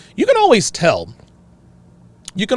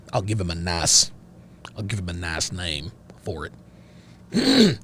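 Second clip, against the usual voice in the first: low, slow Southern character voice; Cletus, aggrieved father; 8 semitones lower, 9 dB quieter, slower than usual. Acted. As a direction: speak slowly and softly, pitched well down, as Cletus. Takes on Cletus's voice.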